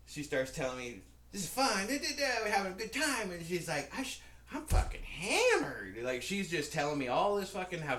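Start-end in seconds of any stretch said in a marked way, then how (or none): 1.3-3.3 put-on high, louder party-girl chatter; put-on high, excitable voice; mock drunk woman. 4.0-6.0 put-on high, loud, slow drunken drawl; mock drunk woman. Drunk woman's confession, acted.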